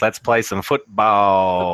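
slow, drawled Southern jock; hammed-up Southern football voice; Southern accent. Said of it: "you gotta get the southern accent".

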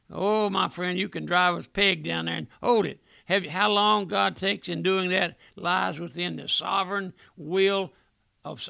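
ordinary delivery

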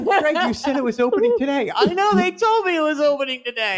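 high whiny fan voice; whiny mock complaint.

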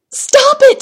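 high, loud scream; full-force frantic yell. A narrator's reading. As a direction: shriek it at the top of your lungs. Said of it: "screamed Jack at the top of his lungs".

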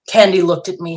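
ordinary delivery

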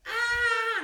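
very high, loud mock scream; long hammed-up shriek; imitated pain scream. Patient getting another go on broken toe, screaming in pain. Acts out second attempt on toe.

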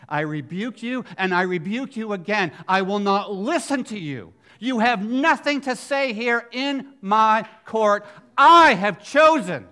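God ruling over Satan in court, rising to a shout; building rebuke. Acted. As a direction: hammer the ruling home, rising each line. Preacher voicing God's court ruling.

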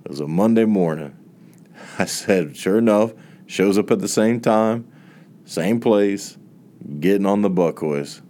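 ordinary talk

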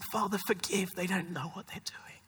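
barely voiced, fading to a whisper; Jesus's words, hushed. Preacher voicing Christ's plea from the cross, hushed. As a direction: breathe it out softly like a dying prayer. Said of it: "he said".

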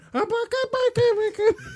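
very high-pitched squawky puppet gibberish; Muppet-style babble. Retold or imitated. Podcaster mocking a show's puppet-like creature to his co-host, Muppet-style babble. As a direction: imitate a Muppet jabbering in a squeaky falsetto. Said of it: "Det er lige sådan noget Muppet Show".

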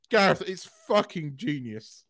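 ordinary delivery

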